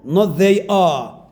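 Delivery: "they" punched in "they are" as two full words; wrong full form. Shown as a demonstration. Teacher contrasting the wrong full form, stressed "they are". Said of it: "That's wrong".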